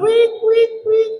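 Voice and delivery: very high squealed pig noise; imitating a squealing pig. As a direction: squeal like a pig being slaughtered. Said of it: "giwik-iwik siya".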